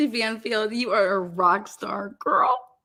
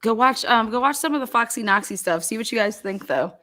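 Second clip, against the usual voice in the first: ordinary talk, nothing marked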